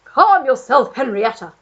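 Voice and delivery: loud blustering Duke calming her; hint of the old Duke soothing. A narrator's reading. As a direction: Duke calming his wife. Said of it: "said the Duke".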